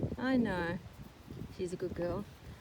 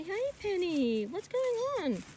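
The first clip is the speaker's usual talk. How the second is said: much higher, slow, sing-song hello; sing-song horse greeting; 11 semitones higher, slower than usual. Real, not acted. Owner greeting her horse Penny on her birthday, high and slow sing-song. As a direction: coo at her like a pet.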